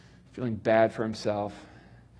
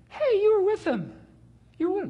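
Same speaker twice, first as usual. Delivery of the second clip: very high, piping accusation; little girl pointing at Peter; accusing girl; faster than usual. Acted.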